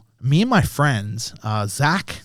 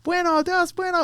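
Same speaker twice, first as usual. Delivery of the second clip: mock Spanish speakers. Tattooer imitating Filipino locals greeting him, high mock Spanish. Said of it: "what I thought was Spanish".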